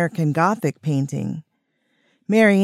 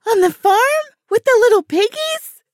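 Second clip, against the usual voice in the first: very high, loud delighted squeal; fangirl squealing; 5 dB louder than usual. A narrator's reading. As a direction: squeal it like a thrilled little girl. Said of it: "squealed Jazzy".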